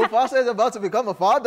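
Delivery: mock South African accent with a laugh; laughing bad South African accent. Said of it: "I'm trying my South African accent".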